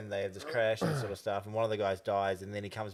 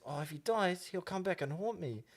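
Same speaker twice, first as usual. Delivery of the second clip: put-on higher ad voice with rural Māori accent; 7 semitones higher, 3 dB quieter than usual. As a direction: mimic the worried ad character's rural Māori accent. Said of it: "the way I was talking right then".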